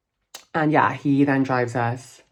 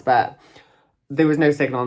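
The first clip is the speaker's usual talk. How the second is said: ordinary delivery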